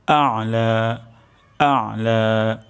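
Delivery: আ'লা drawn out very slowly, আইন from the throat; correct ala. Shown as a demonstration. Teacher holding out the correct আ'লা. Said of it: "উচ্চারণটি হবে আ'লা".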